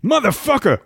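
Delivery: high, tough movie-gangster voice; Samuel L. Jackson impression. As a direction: growl it like a badass gangster. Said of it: "speed round".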